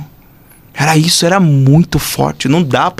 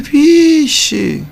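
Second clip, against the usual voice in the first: high, drawn-out cry of dismay; acted-out astonished yelp; startled exclamation; 12 semitones higher than usual. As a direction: stretch it high in mock alarm. Acting out his startled reaction.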